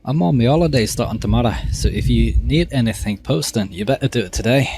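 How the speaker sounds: put-on lilting, improvised Scottish-Irish accent. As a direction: have a go at a sing-song Scottish-Irish accent. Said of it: "Scottish-Irish".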